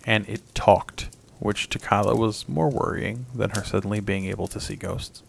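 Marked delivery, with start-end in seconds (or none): none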